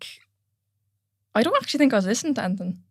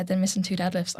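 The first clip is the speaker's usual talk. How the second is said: ordinary talk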